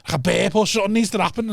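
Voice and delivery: bloated plea. Retold or imitated. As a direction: beg like your gut might burst. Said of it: "I was like".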